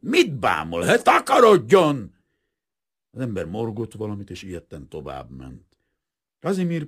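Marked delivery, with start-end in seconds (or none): 0.0-2.2 loud, furious shout, rising high; snarling. A narrator's reading. Painter snarling at a staring passer-by, furious. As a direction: snap the question, then bellow the dismissal. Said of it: "Kazimír rávicsorgott".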